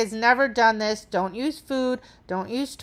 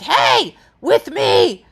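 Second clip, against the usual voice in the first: loud stern "Hey, with me" to the dog; sharp scolding cue; 3 semitones higher, 6 dB louder, much slower than usual. Shown as a demonstration. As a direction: bark "Hey, with me" like a stern correction. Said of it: "but I wasn't like".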